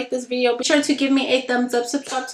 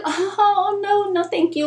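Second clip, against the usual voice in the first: much higher polite "no, no, thank you"; sweet polite refusal; 9 semitones higher, slower than usual. Retold or imitated. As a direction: turn him down gently, pitched way up. Politely turning him down.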